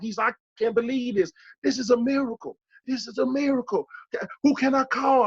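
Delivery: a stunned stranger who can't believe his eyes; astonished bystander. Retold or imitated. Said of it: "he turned around and said".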